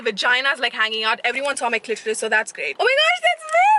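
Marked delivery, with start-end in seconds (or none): 2.8-3.7 high, loud squeal of delight; spotting Millie, excited high squeal. Real, not acted. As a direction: shriek it out, thrilled to see her friend. Spots her friend arriving.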